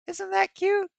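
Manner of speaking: very high squeaky cooing voice; cutesy voice. Pretend. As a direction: coo over it like a baby animal. Cooing over a cute car.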